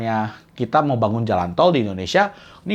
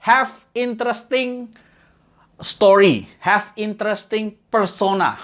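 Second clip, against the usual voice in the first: high, slow English headline read; announcer style; 8 semitones higher, slower than usual. Acted. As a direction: announce the tip like a title. (gaya pembawa acara, tinggi dan lambat)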